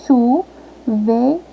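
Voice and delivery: "Chuveiro" slowly split in three; separação silábica. Shown as a demonstration. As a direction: say "Chuveiro" with a gap after each syllable. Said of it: "fala a palavrinha comigo novamente". ("Chuveiro" lento, sílaba por sílaba)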